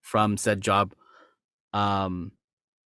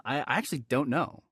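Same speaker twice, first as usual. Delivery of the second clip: ordinary delivery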